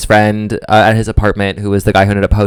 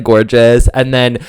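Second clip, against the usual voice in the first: ordinary delivery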